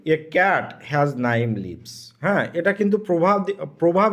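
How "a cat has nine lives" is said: slow dictation. Shown as a demonstration. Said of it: "আমরা ইংরেজিতে তাহলে কি বলতে পারি?".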